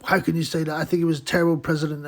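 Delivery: a hammed-up indignant American; mock outraged American. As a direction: play an offended Yank who misses the joke. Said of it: "some guy would go nuts, go".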